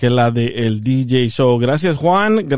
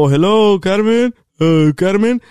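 higher sing-out hello, looking for someone; tentative raised call for someone; 5 semitones higher, slower than usual. Retold or imitated. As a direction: call her name into an empty house. Calls for Carmen on entering house.

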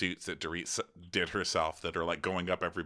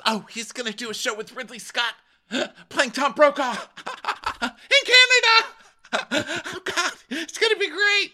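much higher, squealing gush; gushing Rinna impression; 6 dB louder than usual. Imitating Rinna bragging about Harry's role. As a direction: gush with breathless excitement. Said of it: "So Rinna says".

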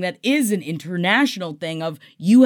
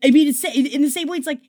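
ordinary talk

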